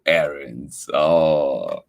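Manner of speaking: ordinary delivery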